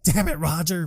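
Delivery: high imitated anguish; hammed-up mourner's cry; mock crying. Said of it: "Chad's crying".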